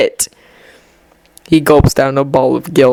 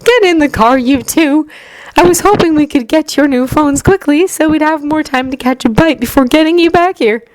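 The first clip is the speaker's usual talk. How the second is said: higher, cheerful mom voice; brisk friendly mother; 10 semitones higher than usual. A narrator's reading. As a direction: sound like a fond, busy mom.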